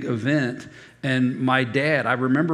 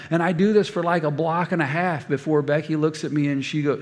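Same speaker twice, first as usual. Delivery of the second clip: ordinary talk, nothing marked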